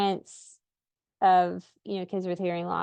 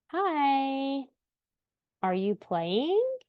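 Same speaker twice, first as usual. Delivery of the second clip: high, slow, overemphasized sing-song; sing-song baby talk; 5 semitones higher, slower than usual. Retold or imitated. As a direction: coo brightly at a baby on the playmat. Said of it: "like, a sing-song, overemphasizing voice".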